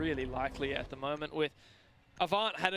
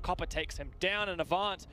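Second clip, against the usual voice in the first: usual speaking voice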